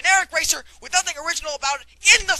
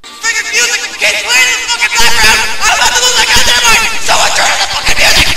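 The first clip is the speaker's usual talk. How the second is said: raging gamer; 2 semitones higher, 10 dB louder than usual. Acted. Gamer rages at looping music.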